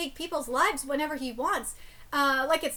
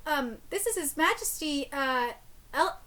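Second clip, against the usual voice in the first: usual speaking voice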